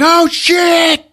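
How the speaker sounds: high, loud mock alarm; hammed-up fright in the spike room; panicked shout. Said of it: "they're like".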